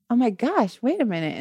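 surprised realization. Retold or imitated. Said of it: "And she's like".

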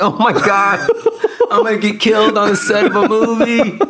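high, laughing mock terror; laughing panic. Retelling the scary night on set, laughing in high mock panic. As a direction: laugh through it like you might really get killed.

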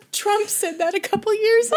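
high, giggly delivery; trying not to laugh; laughing while talking. Real, not acted. Laughing reveal.

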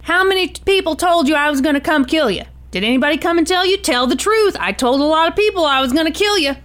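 acted gunman taunting his hostage; Tony. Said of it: "he kinda says to Dick".

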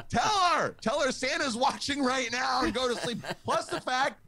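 very high squeaky frantic rant; a parent's squeaky inner panic at losing Santa; panicked inner voice. Retold or imitated. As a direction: let the voice in your head spiral in panic. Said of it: "I had that one voice going".

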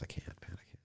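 barely voiced, choked whisper; overwhelmed, nearly silent admission; whispered confession. Real, not acted. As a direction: let it fall to a whisper.

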